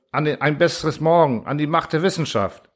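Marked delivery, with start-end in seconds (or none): none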